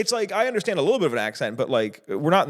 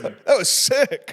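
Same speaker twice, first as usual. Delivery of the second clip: bro voice; 8 semitones higher, 4 dB louder, much slower than usual. Retold or imitated. Continues the bro impression.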